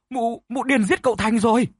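high, loud stammered shriek of alarm; stammering scream. Acted. Servant shrieks that the madwoman killed the young master. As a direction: scream the accusation in terror. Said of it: "la hét thất thanh".

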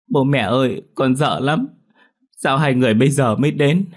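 put-on high-pitched, upset child's plea; tearful child. A narrator's reading. Frightened child crying to her parents, tearful and high. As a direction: plead like a frightened little girl in tears. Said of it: "òa khóc nức nở".

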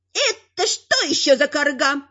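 high-pitched affronted she-troll; exclaiming troll woman. A narrator's reading. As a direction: exclaim in a put-on troll-wife voice. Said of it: "воскликнула троллиха".

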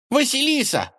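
high, rubbery squeak; squeaky toy. A narrator's reading. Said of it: "пропищала игрушка".

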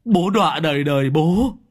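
high, loud, wailing lament; father sobbing to his son, loud and high. Acted. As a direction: cry out to the son in despair. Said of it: "ôm mặt khóc tu tu".

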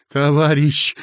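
a mild sob on the word; sobbing plea to the official; sobbing cry. A narrator's reading. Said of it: "зарыдал Коротков".

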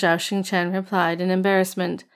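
ordinary delivery

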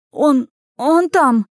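high, slow, loud stammering; frightened stammer. A narrator's reading. Lad points at the burning tavern, frightened. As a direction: stammer it out in alarm.

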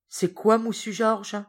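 surprised servant. Acted. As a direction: ask startled, slowly. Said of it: "Ram, surpris".

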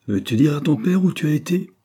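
put-on sharp reproach to the girl; sour voice. Patience scolds Anna before her father, sourly and high. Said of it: "de sa voix la plus aigre".

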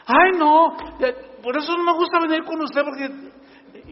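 annoyed churchgoer grumbling at the pastor; whiny complaint. Acted. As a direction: complain like a sulky parishioner.

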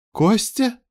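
high questioning cry; startled call. Acted. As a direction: call the name in high-pitched disbelief. Startled friend calls out to Костя.

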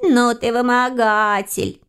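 high, drawn-out teasing; snorting little friend. A narrator's reading. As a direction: scoff slowly in a creature voice. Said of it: "фыркнул его приятель".

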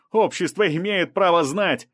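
insistent high shout. A narrator's reading. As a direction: call out defiantly to the whole street. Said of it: "крикнул репортёр".

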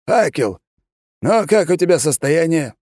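an officer bursting with news; slightly excited. A narrator's reading. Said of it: "слегка возбуждённо осведомился он".

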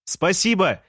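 high, lightly shouted thanks; called out. A narrator's reading. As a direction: call thanks out of a window. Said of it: "выкрикнул я в окно".

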